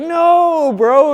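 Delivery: high, loud protesting no; mock protest. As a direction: cut him off in disbelief. [name] retelling his own reaction.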